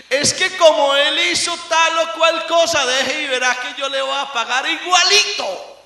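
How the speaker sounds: mock angry grudge. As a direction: play the grudge-holder, half in jest. Voices an angry believer. (creyente rencoroso, enojo fingido y más alto)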